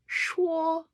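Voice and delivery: 说 held on a high, level note; sing-song 说. Shown as a demonstration. Teacher showing learners how to say 说, sing-song. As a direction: demonstrate 说 like a sung high note. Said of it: "It's like singing".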